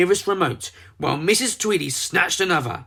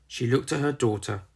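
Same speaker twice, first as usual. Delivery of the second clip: ordinary delivery, nothing marked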